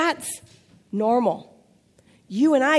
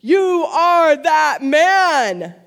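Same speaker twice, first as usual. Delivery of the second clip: louder, higher, very slow, YOU hit hardest; dramatic accusation; 4 semitones higher, 7 dB louder, much slower than usual. Acted. Nathan confronting King David, slow loud accusing punchline. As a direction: land it like the prophet's verdict, one word at a time. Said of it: "Best line".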